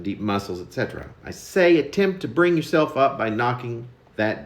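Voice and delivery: lively, theatrical reading of his own poem; poem recital. Acted. Poet reading his daily poem to his viewers, theatrical recital. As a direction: perform the verse like a wry daily news report.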